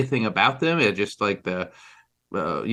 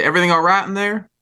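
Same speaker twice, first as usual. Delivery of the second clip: higher and louder, called quickly through the glass; calling through a window; 7 semitones higher, 5 dB louder, faster than usual. Acted. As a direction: call in to whoever is inside.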